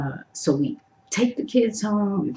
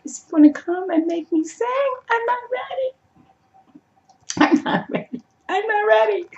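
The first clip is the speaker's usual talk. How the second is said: high-pitched squealing panic, repeating it; excited panic; 14 semitones higher, faster than usual. Retold or imitated. Fan panicking that the singer will pull her up, high squeal. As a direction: squeal it like a starstruck fan about to be called up.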